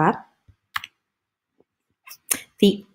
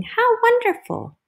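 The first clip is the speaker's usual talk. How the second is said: very high, delighted cooing; gushing admirers; 3 dB louder than usual. Acted. Admirers looking at her work, high gushing praise. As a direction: gush it way up high. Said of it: "they're looking and they're saying".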